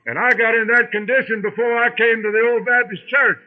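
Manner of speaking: preaching. Acted. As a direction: lift the line into full preaching. Repeats line, rising to preach.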